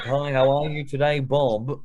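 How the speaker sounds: imitating a dull, scripted sales greeting; mock retail script voice; mock boring greeting. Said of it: "say some fucking boring shit like".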